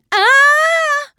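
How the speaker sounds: very high, loud shriek; startled cry at her glowing hoof; Trixie's scream. Acted. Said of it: "schrie Trixie". (schriller Schrei)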